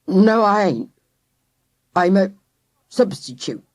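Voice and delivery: put-on gruff, strained old man. A narrator's reading. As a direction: force it out gruff and tight. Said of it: "in a gruff, strained voice".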